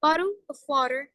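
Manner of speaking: slow American "bottle of water" with tapped t's; American accent. Shown as a demonstration. Teacher demonstrating for students, American accent. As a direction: say "bottle of water" American-style, t's softened to quick d's. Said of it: "إذا بدنا نروح على الأمريكان".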